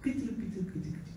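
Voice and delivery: hushed. Retold or imitated. Whispered hushed aside.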